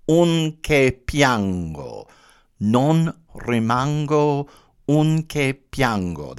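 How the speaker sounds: Non rimango, un che piango drawn out slow, each rhyme ending leaned on; slow Italian rhyme demo, with the rimango and piango endings marked; showing the rhyme. Shown as a demonstration. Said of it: "That's the rhyme".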